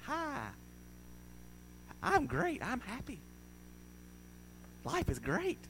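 fake happy. Mocking always-happy people with a fake sing-song chirp. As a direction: sell the false cheerfulness. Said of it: "always going around like this".

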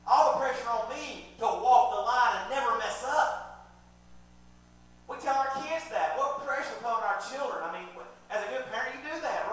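high, building sermon delivery; impassioned preaching. Real, not acted. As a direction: press the burden home, rising in force. Preaches at full force.

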